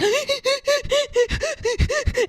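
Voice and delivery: high, hammed-up sobbing in quick bursts; mimicked hysterical sobbing. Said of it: "I'm, like, hysterically sobbing. I'm just like".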